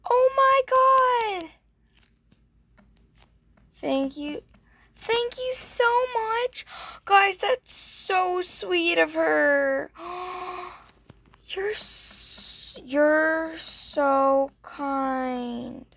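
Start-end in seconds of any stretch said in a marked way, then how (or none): 0.1-1.5 high, slow, stretched-out squeal of delight; excited squeal. Real, not acted. Player thrilled by a surprise gift, squealing. 5.0-6.6 much higher, slow, gushing thanks; high squeal. Real, not acted. Thanking the friend for the gift, squealing high. 7.0-15.9 slow, higher, tearful gushing; touched, near tears. Real, not acted. Telling viewers how sweet the giver is, tearful. Overwhelmed by gift.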